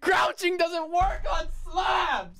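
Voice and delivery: hammed-up victory shout; mock triumphant announcement. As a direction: announce the win like a hero.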